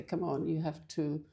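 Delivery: normal talking voice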